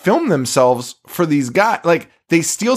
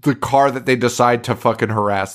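ordinary talk, nothing marked

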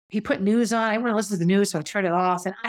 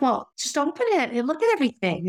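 higher-pitched, impatient comeback; exasperated retort; 6 semitones higher than usual. Retold or imitated. Woman recalling her reply to an insulted present, mildly exasperated. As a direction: push back with a lifted voice.

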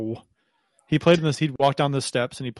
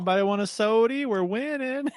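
imitated vendor's call; 9 semitones higher than usual. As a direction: call it out like the arena soda vendor. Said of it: "he had a good shtick".

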